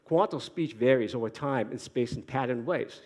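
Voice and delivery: thick native Boston accent, r's dropped. Shown as a demonstration. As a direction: say it like a born Bostonian. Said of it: "in my native Boston accent".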